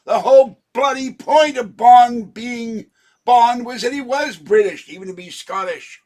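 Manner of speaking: ranting that Bond must be British; indignant rant. Real, not acted. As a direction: rail against them, voice up high.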